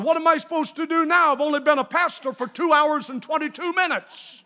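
high-pitched, driving revival testimony; full-force preaching. Real, not acted. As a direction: preach it up to a ringing peak. Preacher builds testimony to a climax.

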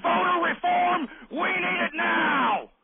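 high, drawn-out furious yell; full-throated political rant shout; angry shout. Real, not acted. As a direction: roar the demand, stretching the last word. Host ranting at listeners.

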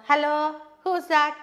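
blunt, loud demand of who it is; rude phone greeting. Shown as a demonstration. A teacher showing a rude caller, loud and curt. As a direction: bark it like bad manners. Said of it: "Did she ask like that?".

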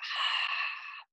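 long throaty exhale through the nose; ujjayi breath demo. Shown as a demonstration. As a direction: breathe out like fogging a mirror. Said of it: "you're kinda that".

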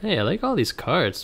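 ordinary talk, nothing marked

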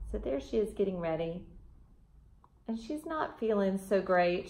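ordinary delivery, nothing marked

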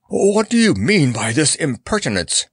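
high-pitched, panted demand; panting outrage. A narrator's reading. Mustafa seizing Tazzy's arm, panting in outrage. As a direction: demand it indignantly, short of wind. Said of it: "panted Mustafa".

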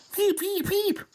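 very high alarm beeps; statue beeping. Pretend. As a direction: beep like a shrill alarm. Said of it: "einmal präsentiert, was passiert".

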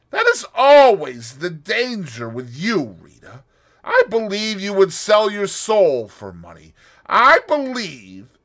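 loud, high accusing; angry accusation. Acted. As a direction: accuse her bitterly. Boris accusing.

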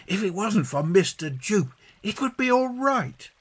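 put-on high child's voice, complaining lightly; John. John to his sister about Mister Jupe, high child voice. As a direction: pitch it up like a worried child.

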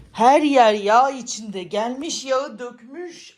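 loud slow exasperated cry; exasperated outcry. Real, not acted. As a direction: wail at the mess. Despairing at grease everywhere.

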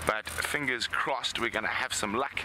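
normal talking voice

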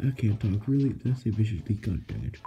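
ordinary talk, nothing marked

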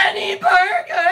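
high, loud, whiny wail of a demanding customer; mock the drive-thru customer's crying demand. Worker imitating a rude customer whining loudly at the window.

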